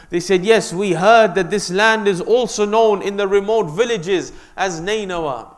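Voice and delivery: loud, high, declaiming sermon voice; impassioned preaching. Acted. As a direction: call out to the villagers in full sermon. Imam questions the villagers.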